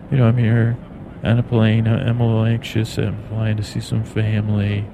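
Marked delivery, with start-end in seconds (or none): none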